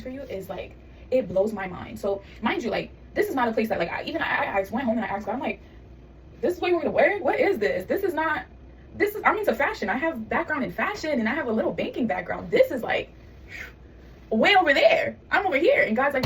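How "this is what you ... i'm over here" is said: higher-pitched, exasperated questioning; incredulous protest. Retold or imitated. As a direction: protest in disbelief, pitch swooping up. Acted protest to God.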